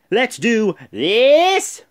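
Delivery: high, loud, drawn-out hype shout; hype call. Real, not acted. Gamer pumping up his audience before the last matches, loud hype. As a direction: pump up the viewers. Hyping the rest of show.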